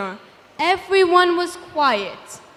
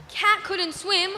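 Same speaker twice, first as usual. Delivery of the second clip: plain reading voice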